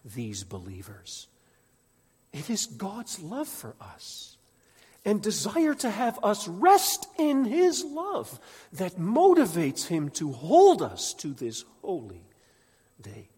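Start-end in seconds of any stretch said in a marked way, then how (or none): 2.3-13.3 high, loud impassioned preaching; preaching at full force. Acted. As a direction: preach it with rising fire.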